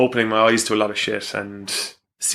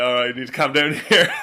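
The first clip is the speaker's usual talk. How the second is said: laughing; 3 semitones higher, faster than usual. Real, not acted. Laughs while quoting himself.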